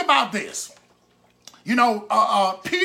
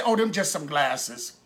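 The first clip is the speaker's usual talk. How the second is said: low, offhand shrug of a voice; mock an unimpressed onlooker, lower in pitch; dismissive; 7 semitones lower, 4 dB quieter than usual. Most people looking at his treasured glasses, dismissive.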